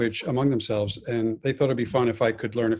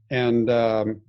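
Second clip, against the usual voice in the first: normal talking voice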